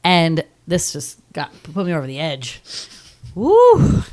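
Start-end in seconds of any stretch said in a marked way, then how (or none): 3.3-4.0 high, loud "woo" of release; emotional whoop. Real, not acted. Host pushed over the edge, whooping. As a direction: let out a big whoo. Letting out emotion after tearful recap.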